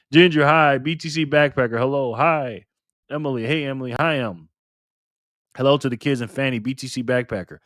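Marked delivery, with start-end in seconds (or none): none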